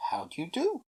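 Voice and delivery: lightly voiced, quiet little elf hello; polite elf greeting. A narrator's reading. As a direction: greet a stranger gently and courteously. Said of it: "said Eric".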